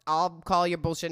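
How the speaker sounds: mock dad calling it out loudly; imitating dad. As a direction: play a dad loudly objecting. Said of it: "I can hear my dad right now".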